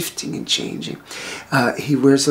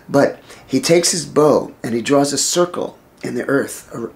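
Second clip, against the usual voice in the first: usual speaking voice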